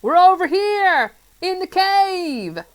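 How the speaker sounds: high, loud helpful calling; shouting. A narrator's reading. Friday calling to the rainbow spirit, loud. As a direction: shout directions across a distance. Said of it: "he shouted helpfully".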